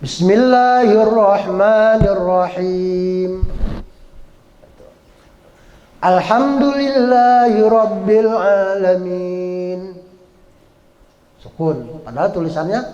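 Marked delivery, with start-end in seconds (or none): 0.0-9.9 slow chanted Quran recitation. Shown as a demonstration. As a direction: recite it slowly, as in prayer. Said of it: "rubah menjadi sukun".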